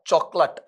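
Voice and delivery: चॉकलेट ending in a short "lut"; correct pronunciation. Shown as a demonstration. Teacher confirming the right form, चॉकलेट with short last vowel. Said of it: "चॉकलेट ऐसा आएगा".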